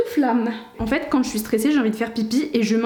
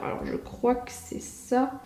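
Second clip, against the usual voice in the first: nervous whisper; barely breathed under the breath; 9 dB quieter, slower than usual. Real, not acted. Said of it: "je suis stressée".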